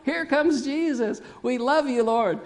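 high, excited mock acclaim; adoring crowd. Crowd greeting Jesus as he comes, high excited acclaim. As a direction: call out like a welcoming crowd. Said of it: "cried out".

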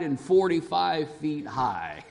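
usual speaking voice

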